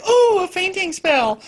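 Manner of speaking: put-on high, airy fainting-girl voice; mock swoon. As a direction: swoon dramatically like a frail heroine. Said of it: "like, anemic".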